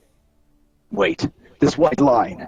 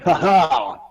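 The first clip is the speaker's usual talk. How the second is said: normal talking voice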